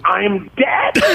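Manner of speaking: high, incredulous imitation of mom; mock shocked mom. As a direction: squeak it as an affronted mother. Mom learns her character died.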